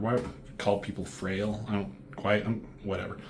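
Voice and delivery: normal talking voice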